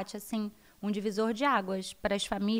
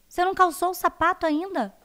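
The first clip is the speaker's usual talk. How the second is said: put-on higher and louder, scolding the child; mother losing patience, shoes still not on; exasperated mother; 7 semitones higher, 7 dB louder than usual. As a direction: snap at a daughter who still has no shoes on. Mother scolding late daughter.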